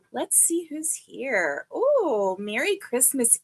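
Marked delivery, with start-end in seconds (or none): 0.1-1.7 "Let's see who's here" said slower and softer, drawn out; soft slow repeat. Real, not acted. Host scanning the chat, murmuring "Let's see who's here" slowly. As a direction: drift into it quietly as she scrolls.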